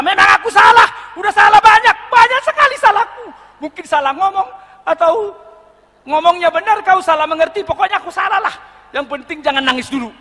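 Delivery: high, loud, hammed-up apology; frantic apology. Husband begs crying wife to stop, frantic apology. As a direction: play a husband hastily taking all the blame.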